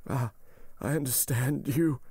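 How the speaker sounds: high, strained voice of a suffering man; unhappy. A narrator's reading. Dying servant realizes what the doctor means, unhappy and strained. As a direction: grasp your fate in anguish. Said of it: "said the unhappy man".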